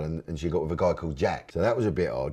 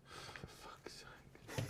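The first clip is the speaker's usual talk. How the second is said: whispered curse; 20 dB quieter than usual. Real, not acted. Exasperated at the quiz.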